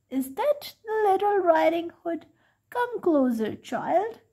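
put-on sweet and high to lure the girl closer; wolf's squeaky voice. A narrator's reading. Wolf in bed calls the girl closer, in a squeaky put-on voice. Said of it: "in a squeaky voice".

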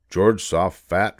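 usual speaking voice

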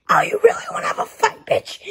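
tiny high voice itching for a fight; squeaky fighter voice. Acted. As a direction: squeak out the dare, fast. New character picks a fight.

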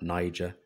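Niger said NY-jer, long i and soft g; wrong pronunciation of Niger. Shown as a demonstration. Narrator rejects another misreading of the king's byname, Niger as NY-jer. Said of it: "it's not Niger".

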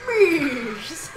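loud, falling whinny on the word; horse impression. Friend revives the horse gag, loud neighing. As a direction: neigh it out, laughing.

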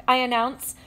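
usual speaking voice

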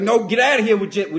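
brisk acted refusal; firm rebuff. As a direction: shoo off the flirt. Acts rebuff to flirting driver.

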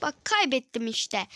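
ordinary talk, nothing marked

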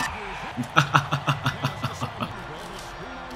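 quiet, drawn-out giggling; long laugh. Real, not acted. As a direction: keep laughing softly. Laughing after a play.

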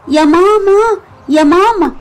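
calling out to mom. Acted. Child calling mother to complain.

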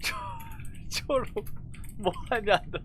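ordinary talk, nothing marked

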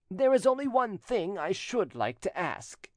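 high-pitched, pressing cousin; insistent rejected suitor. A narrator's reading. Jilted suitor asking his cousin a favour, pressing.

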